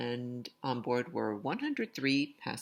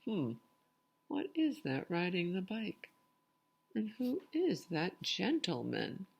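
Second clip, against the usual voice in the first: curious searching; 8 semitones higher than usual. Pretend. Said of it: "Kind of like an I Spy".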